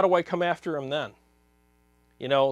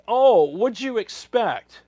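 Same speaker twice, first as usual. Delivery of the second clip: mock exasperated; 5 semitones higher, 7 dB louder, much slower than usual. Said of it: "people like me will be like".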